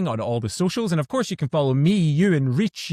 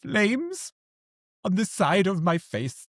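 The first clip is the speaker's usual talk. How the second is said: high-pitched, hammed-up exasperation; mock anger; 8 semitones higher, slower than usual. Colleagues quoting Clue when something irks them, high mock anger. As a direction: play irked in a raised, theatrical voice. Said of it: "look at each other and go".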